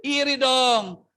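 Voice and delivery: high mock whine, drawn out; mock whining. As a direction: whine like a spoiled child. (rengekan manja pura-pura)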